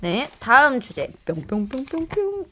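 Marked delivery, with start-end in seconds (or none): 1.3-2.4 soft, slow vocal blips; pyong-pyong sound effect. Pretend. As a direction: imitate a little game-show selection sound. Spoken sound effect choosing next topic. (작고 느린 뿅뿅 효과음)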